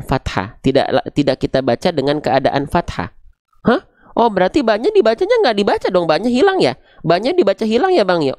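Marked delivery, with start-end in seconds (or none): 3.6-8.3 higher-pitched pupil's questioning; puzzled student. Acted. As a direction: play a learner checking with the teacher. Acts a puzzled student asking. (murid bertanya dengan nada tinggi)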